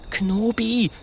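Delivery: plain reading voice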